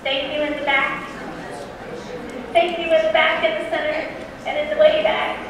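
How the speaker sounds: loud thanks called across the room; calling out. Real, not acted. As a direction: project to the back rows.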